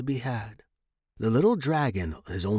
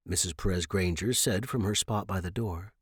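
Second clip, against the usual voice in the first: plain reading voice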